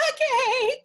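very high, loud mock-polite squeal; strained politeness. Reacting to an actor's accent at an audition, squeaky awkward politeness.

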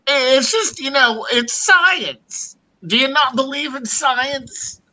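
mock Biden voice pitched high; Joe Biden impression. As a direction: pitch it up like an old president pleading. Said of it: "Just use your Joe Biden voice".

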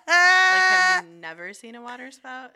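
loud incredulous high yell; mock shout. Locals yelling at the farmer in disbelief, mock Australian shout.

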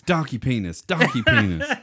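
mock yelling at remote.

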